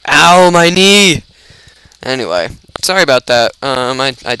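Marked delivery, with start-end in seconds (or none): 0.1-1.2 loud, high, drawn-out mock howl; mock pain yell. Player hit in the knee in game, mock pain yell. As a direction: yell as if playfully hurt, stretching it out.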